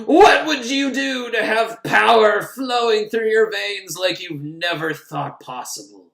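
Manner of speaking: slow, looming tempter voice; seductive villain. Retold or imitated. Villain tempting her with power, slow and looming. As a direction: draw her in slowly, like a devil's offer. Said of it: "he's like".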